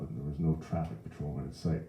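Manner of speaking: ordinary delivery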